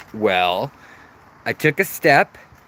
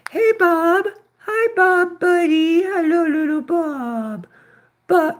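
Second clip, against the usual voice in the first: much higher, sing-song coo for a fish. Real, not acted. Owner greeting betta fish Bob in high baby talk.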